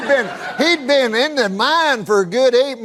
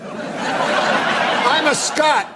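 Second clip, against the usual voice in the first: laughing; 6 semitones higher, much slower than usual. Acted. Claims Scottish heritage mockingly.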